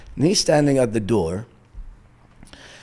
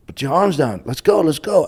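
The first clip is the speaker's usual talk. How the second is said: higher, impatient command; angry challenge; 7 semitones higher, 4 dB louder than usual. Retold or imitated. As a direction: brush him off and push for the fight. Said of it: "I'm getting even more angry".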